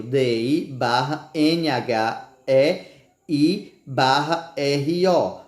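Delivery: slow letter-by-letter spelling. Shown as a demonstration. Teacher spelling dinheiro slowly.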